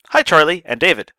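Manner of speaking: usual speaking voice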